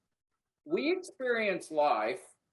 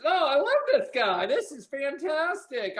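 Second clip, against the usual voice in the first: mock delight; 7 semitones higher than usual. Mocking a customer who says no.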